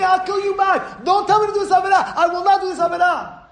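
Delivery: fierce high shouting at the tempter; scream at temptation. Shown as a demonstration. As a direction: drive the evil inclination away. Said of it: "Scream at the Yetzer Hara".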